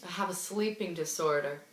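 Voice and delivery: low, very soft imitation of him; subdued confession. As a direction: admit it quietly, as the man leaving. Acted sheepish confession by Brad.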